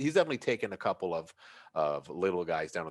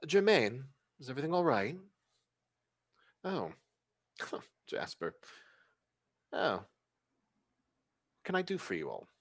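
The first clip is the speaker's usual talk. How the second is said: elegant hostess voice; 7 semitones higher than usual. Acted. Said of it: "She kinda opens up and goes".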